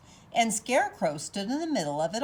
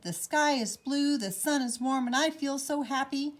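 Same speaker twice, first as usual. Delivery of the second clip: cheerful scarecrow; 7 semitones higher than usual. Acted. Scarecrow cheerfully greeting the day.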